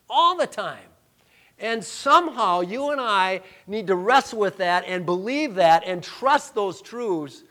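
loud, rising pulpit preaching; full-force preaching. Acted. Pastor preaching God's sovereignty at full force. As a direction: build it up to the church.

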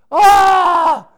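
sudden high, loud cry of alarm; a burst of fake panic; a hammed-up yelp of frustration; mock panic cry. Acting out getting worked up.

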